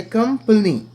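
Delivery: कंपनी high and loud as "kum-pul-nee"; falling end on कंपनी, pitched high; pronunciation demo. Shown as a demonstration. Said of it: "इस तरह पढ़ें". (कंपनी का उच्चारण, ज़ोर से)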